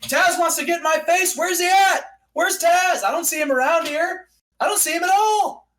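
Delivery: high mocking challenge to the crowd; taunting callout. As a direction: call out a rival who is hiding. Wrestler calling out rival in promo.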